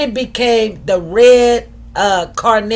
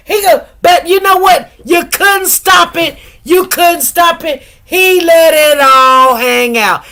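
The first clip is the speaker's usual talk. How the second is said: excited; 5 semitones higher, 4 dB louder than usual. Real, not acted. Laughing excitement about the testimony.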